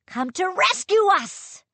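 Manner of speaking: loud, high, eager shout; hopeful cry. Acted. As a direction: call out with sudden hope. Sailor hopes for rescue.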